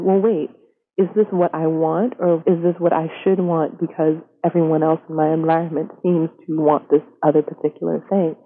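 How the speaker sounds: normal talking voice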